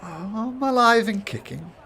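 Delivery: cocky high voice. Acted. GM voices shadowy woman.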